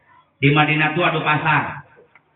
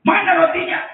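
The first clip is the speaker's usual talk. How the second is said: high eager ask; faster than usual. Acted. Abu Bakar eager.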